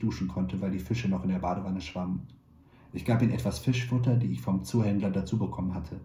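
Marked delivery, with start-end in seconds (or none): none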